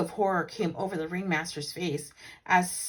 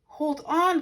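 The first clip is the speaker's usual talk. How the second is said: higher, louder call; 8 semitones higher, 5 dB louder than usual. Acted. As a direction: call across the ring. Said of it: "called his partner".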